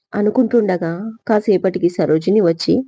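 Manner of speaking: usual speaking voice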